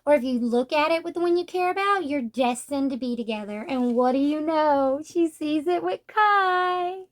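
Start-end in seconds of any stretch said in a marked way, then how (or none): 5.1-7.0 mock swoon. Real, not acted. Gushing over romantic moment.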